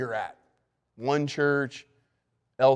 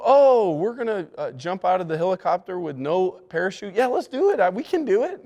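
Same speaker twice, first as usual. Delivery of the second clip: much higher and louder, gung-ho and carried away; reckless mock optimism; 6 semitones higher, 3 dB louder than usual. Preacher mocking blind enthusiasm, hammed-up eager cheer. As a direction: ham up a blindly eager yes-man. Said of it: "A can-do attitude doesn't mean we go".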